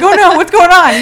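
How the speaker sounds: high, loud mock panic; high, loud laughing alarm. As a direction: play the worried friend, laughing. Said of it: "I was like".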